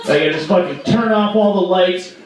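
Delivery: normal talking voice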